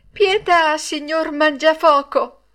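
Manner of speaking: high, loud plea for mercy; pleading. A narrator's reading. Pinocchio begs the puppet master for mercy, high and pleading. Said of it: "cominciò a dire con voce supplichevole".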